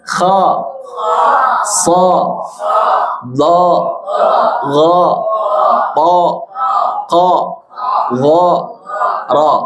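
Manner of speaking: each syllable with mouth wide open on a; teacher drilling open fathah syllables. Shown as a demonstration. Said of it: "ibu-ibu sekali ya".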